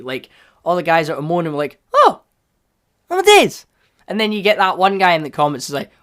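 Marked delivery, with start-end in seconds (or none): 1.9-3.7 high, loud exclamation; hammed-up fan gasping out words; mock astonishment. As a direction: cry out in comic amazement. Voices moaning fans.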